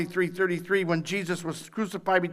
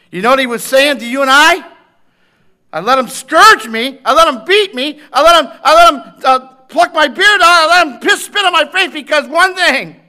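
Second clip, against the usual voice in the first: loud, high preached voice of Christ; Christ crying out; 7 semitones higher, 16 dB louder than usual. Acted. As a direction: cry each line out as Christ suffering.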